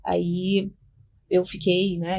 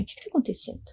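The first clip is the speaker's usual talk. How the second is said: curt question in a whisper; whispered dry question; 2 semitones higher, 9 dB quieter, much faster than usual. Retold or imitated. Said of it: "Bem, bem seca". (pergunta seca sussurrada)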